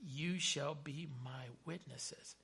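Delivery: slow, soft, measured recitation; solemn scripture quote. Acted. Preacher quotes scripture to congregation after a story, solemn and slow. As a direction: let the verse land slowly and quietly.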